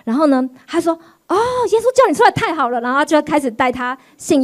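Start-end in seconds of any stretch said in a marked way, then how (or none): 1.3-2.8 high, rapid burst of joy; fast, higher happy exclamation; delighted reply. Retold or imitated. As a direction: blurt out the good news gleefully.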